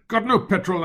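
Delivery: irritated jab at the dawdling cabby; snapped. Acted. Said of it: "I snapped".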